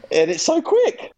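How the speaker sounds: high gasp then thrilled line; excited gasp. Real, not acted. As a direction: gasp with delight. Excited about takeaway order.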